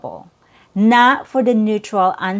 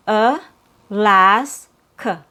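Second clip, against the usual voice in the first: Alaska stretched slowly syllable by syllable; much slower than usual. Shown as a demonstration. Teacher slowing Alaska down for learners. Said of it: "three syllables".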